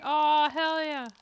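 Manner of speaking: slow, loud, stretched cheer; drawn-out delight. Real, not acted. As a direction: savour it slowly. Enjoying the setup.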